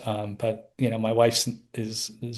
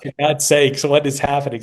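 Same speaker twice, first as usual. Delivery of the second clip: higher, louder bewildered outcry; retold exasperation; 4 semitones higher, 7 dB louder than usual. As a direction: exclaim in mock desperation, voice raised. Retold panic over motionless dog.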